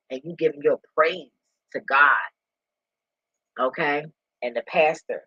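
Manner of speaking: usual speaking voice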